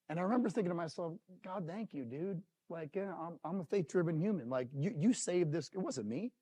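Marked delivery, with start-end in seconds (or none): 1.4-6.3 hushed, moved thanks; quiet, heartfelt gratitude; emotional thanks. Retold or imitated. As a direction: say it softly, still affected. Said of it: "I'm emotional".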